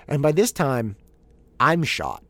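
ordinary talk, nothing marked

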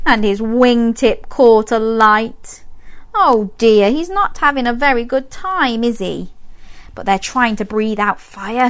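usual speaking voice

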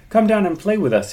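high calling out. A narrator's reading. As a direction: call up to your brother. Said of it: "he called".

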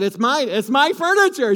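high, loud, eager claim; wife bursting with excitement; excited. Retold or imitated. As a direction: insist it's yours with a thrill. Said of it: "She got all excited".

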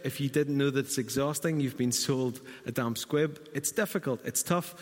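ordinary delivery

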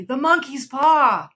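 high, slow, desperate cry; wild cry. A narrator's reading. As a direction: drag the words out in a frantic plea. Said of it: "she cried wildly".

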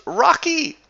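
very high, loud excited shout; excited calling. Retold or imitated. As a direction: announce the guest to mama with joy. Farmer announcing guest excitedly.

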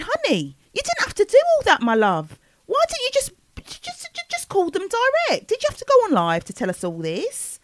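high, swooping mock exasperation; incredulous, high-pitched mock scolding; exasperated pleading. Mock advice addressed to [name].

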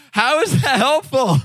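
high-pitched baffled protest; mock exasperation. Guy reacting to an unhelpful name, exasperated.

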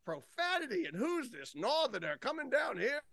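high-pitched mimicry of scandalized critics; mock Bible Belt critic. Camp director imitating his session's critics, mock outrage. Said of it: "it's like the Bible Belt killed me".

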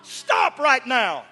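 loud, high, building sermon climax; preaching at full force. Acted. Preacher rousing the congregation to keep coming. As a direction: preach it out with rising fire.

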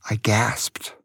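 put-on a scared boy's gasped whisper. A narrator's reading. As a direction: hush it out in a frightened breath. Scared boy gasps at name.

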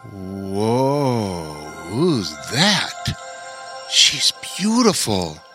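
awestruck wizard. A narrator's reading. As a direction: marvel at a lovely stranger. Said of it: "Wander stammered".

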